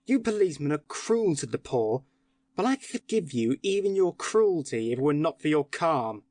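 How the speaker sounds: angry tirade. Acted. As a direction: pour out scorn at the calm policeman. Syme rages at the policeman.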